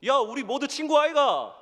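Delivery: loud, high, slow Gyeongsang dialect rallying cry; slow, high Gyeongsang shout to the bullying classmates; Gyeongsang call. Pretend. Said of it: "아주 경상도를 잘 썼어요".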